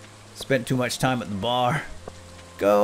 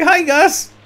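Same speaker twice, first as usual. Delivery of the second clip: mock greeting; 11 dB louder, much slower than usual. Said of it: "He's like".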